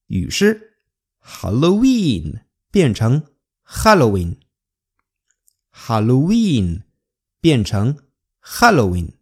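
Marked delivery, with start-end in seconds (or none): 1.2-4.4 Halloween said with a Spanish j and stress on ha; Hal-lo-ween, jota and first-syllable stress; 西班牙语发音. Shown as a demonstration.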